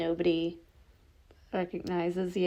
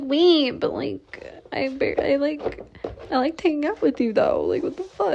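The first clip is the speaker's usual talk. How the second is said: mock upset protest; 7 semitones higher, 5 dB louder than usual. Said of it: "you're sad 'cause you're like".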